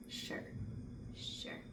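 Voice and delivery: very soft, deadpan, spaced out; skeptical "sure". Retold or imitated. Doubting friend's promise it's never like that, hushed skepticism. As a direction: drop it quietly, with pauses between.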